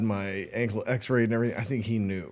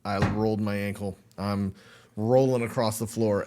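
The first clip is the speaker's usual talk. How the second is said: ordinary talk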